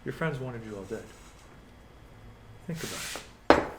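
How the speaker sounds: very soft, low villain murmur; menacing whisper. Acted. Villain whispering so a bystander cannot hear, hushed menace. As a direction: keep it secret, close and hushed. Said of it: "No, he whispers it".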